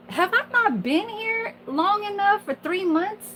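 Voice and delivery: high-pitched, mildly indignant rhetorical question. Acted. Dietary aide privately protesting a coworker's question, mildly indignant.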